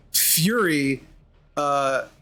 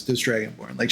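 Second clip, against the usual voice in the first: ordinary talk, nothing marked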